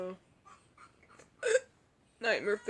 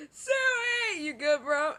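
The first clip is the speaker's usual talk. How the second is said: shouting out; 5 semitones higher, 6 dB louder, much slower than usual. Real, not acted. Friend falls, calling out.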